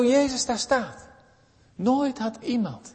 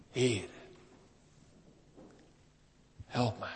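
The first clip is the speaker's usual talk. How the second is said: soft, humble begging, much quieter; hushed plea; 9 semitones lower, 15 dB quieter than usual. Acted. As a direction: kneel and plead under the breath. Woman kneels and begs Jesus. (zacht smekend gebed)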